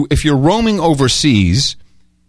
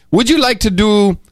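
imitating an Indian call-centre agent, higher voice; mock Indian accent, pitched up; 8 semitones higher than usual. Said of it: "I'm sorry to do the accent".

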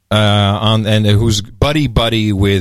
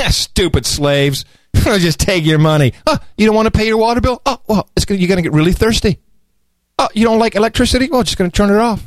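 high, fast sneering voice of the power company; mock greedy company; 7 semitones higher than usual. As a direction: sneer at the customers like a smug utility. Mocking the utility company's voice.

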